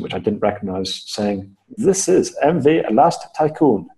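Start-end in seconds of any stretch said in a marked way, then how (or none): 1.8-3.9 put-on slow, booming Russian-accented ship radio call. Ship's officer radioing the stranded rower, slow booming Russian accent. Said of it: "in a Russian accent".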